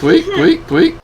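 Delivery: squeaky high echo; high silly echo. Pretend.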